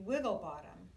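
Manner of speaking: usual speaking voice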